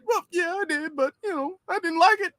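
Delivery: high teary whine; mock crying. Mocking the politician's tearful excuse, high whine. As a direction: sniffle through it, hammed up.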